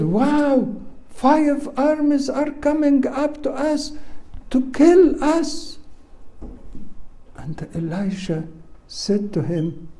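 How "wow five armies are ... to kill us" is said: high-pitched alarmed cry; acting out a panicked servant; frightened disciple. Disciple warns Elisha of five armies coming, high-pitched alarm. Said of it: "he was still frightened".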